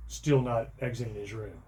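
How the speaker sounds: ordinary delivery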